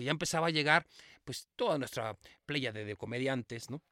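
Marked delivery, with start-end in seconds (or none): none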